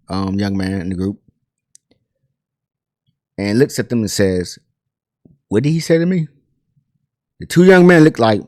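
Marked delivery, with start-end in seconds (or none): 5.5-6.3 higher-pitched, questioning delivery; taken-aback question. Retold or imitated. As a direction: ask it in disbelief, pitch lifted. Marvin questions boys after insult.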